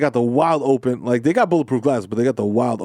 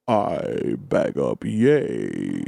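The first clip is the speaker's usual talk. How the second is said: put-on old senator voice; 4 semitones lower, slower than usual. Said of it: "Mitch McConnell come through like".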